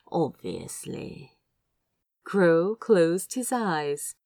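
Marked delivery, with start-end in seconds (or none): 0.1-1.4 softer, much lower, slow echo of the word. Acted. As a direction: echo it back low and slow, like a private joke. Crow echoes Azra's word back.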